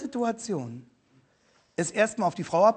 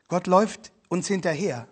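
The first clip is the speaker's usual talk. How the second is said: ordinary talk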